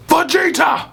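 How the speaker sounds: very high, slow strained shout; hammed-up Prince of Saiyans yell; Vegeta voice. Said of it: "I can't do it right now".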